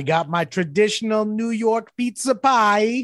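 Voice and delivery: high, slow put-on New York accent; mock New York accent. Telling the cohost about his trip in a mock New York accent. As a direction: ham up a New York accent for the pizza pie. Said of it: "I don't know what that accent was".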